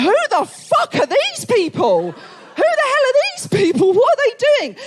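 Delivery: high, loud incredulous outburst; outraged disbelief. Retold or imitated. Comic mum outraged at the hospital's sieve advice. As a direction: shriek it in disbelief.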